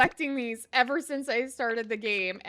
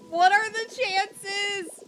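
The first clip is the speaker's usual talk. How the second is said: surprised; 7 semitones higher, 5 dB louder, much slower than usual. Real, not acted. Unlikely event in game.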